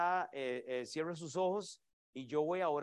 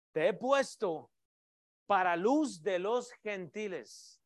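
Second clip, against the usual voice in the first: preaching at full force; 4 dB louder, slower than usual. Acted. Proclaims verse with names.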